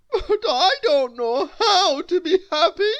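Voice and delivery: high, loud tearful voice; sniffling. A narrator's reading. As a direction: sniffle through it. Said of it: "sniffed the king".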